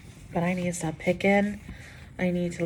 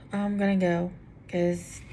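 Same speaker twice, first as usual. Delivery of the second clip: ordinary delivery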